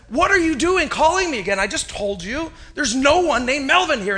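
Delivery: loud, high-pitched acted outrage; irate. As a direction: tell off an unwanted caller, worked up. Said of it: "This time the guy got irate".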